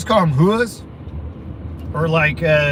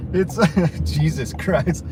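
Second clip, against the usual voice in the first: ordinary talk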